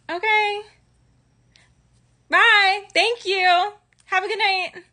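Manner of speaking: high, bright customer-service goodbye; fake cheerful voice. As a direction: chirp it like you want him gone.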